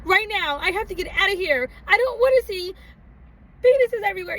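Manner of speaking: high urgent demand; desperate to leave; earnest young self begging; insistent plea. Retold or imitated. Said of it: "I said".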